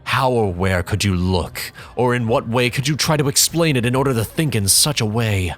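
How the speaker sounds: plain reading voice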